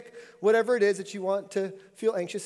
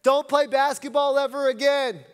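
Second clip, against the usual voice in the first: mocking trash-talking mom; 5 semitones higher, 4 dB louder than usual. Sports mom heckling homeschool kids, mock jeer. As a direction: sneer like a heckling parent in the stands. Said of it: "they were saying things like".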